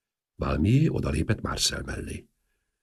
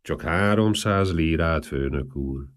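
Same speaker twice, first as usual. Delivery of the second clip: plain reading voice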